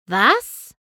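hurt disbelief; shocked what. A narrator's reading. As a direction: Pinkie stunned by Twilight's outburst. Pinkie hurt by harsh words.

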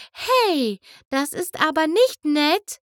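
Fluttershy calling out. Acted. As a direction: call out, mildly scolding. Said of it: "rief Fluttershy". (Fluttershy ruft leicht tadelnd)

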